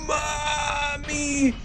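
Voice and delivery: high panicked cry for mom; a wailing Mommy; terrified scream. Acted. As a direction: scream as you fall to your fate. Falling into acid, screaming.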